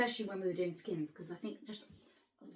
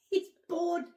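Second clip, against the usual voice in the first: laughing; 10 semitones higher, 7 dB louder than usual. Real, not acted. Laughing joke about fans.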